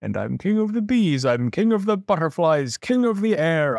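high-pitched royal boasting; gleeful king proclaiming his realm; exultant shout. A narrator's reading. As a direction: crow each new title in triumph. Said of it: "shouted Yertle".

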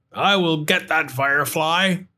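gruff character voice; a cross vendor; grumpy hot dog man. Acted.

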